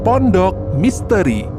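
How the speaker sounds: ordinary delivery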